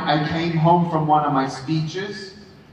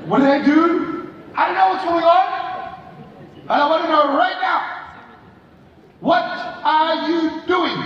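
retold confrontation; 8 semitones higher than usual. Mother and son confrontation retold.

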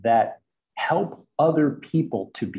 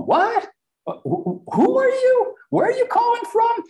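high, loud, stammering confusion; struggling on phone; 5 dB louder than usual. Retold or imitated. Coworker struggling with a foreign caller on the phone, loud confusion. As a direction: imitate someone baffled by a caller. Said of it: "I could see him struggle on the phone".

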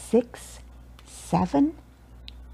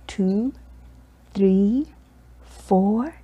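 ordinary delivery

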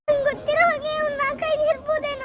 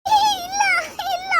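1 frightened cry; 6 semitones higher, 4 dB louder than usual. Acted. Terrified answer to the ghost.

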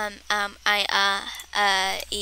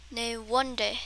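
normal talking voice

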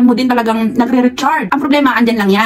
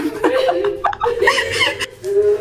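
very high, long burst of laughter; real laughing fit; shrieking laugh; 5 dB quieter than usual. Real, not acted. Laughing at own imitation.

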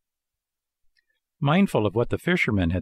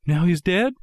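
high, rising sorrowful question; mildly sorrowful; 8 semitones higher, 4 dB louder than usual. A narrator's reading. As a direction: cut in, fearing bad news, higher-pitched. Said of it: "interrupted sorrowfully".

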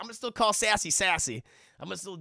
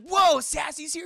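surprised shout; 9 semitones higher, 7 dB louder, slower than usual. Retold or imitated. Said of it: "And he's like".

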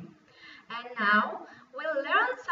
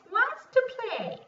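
high and loud sing-song; 12 semitones higher, 7 dB louder than usual. Shown as a demonstration. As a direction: lift it up high for the child. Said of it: "Now repeat after me".

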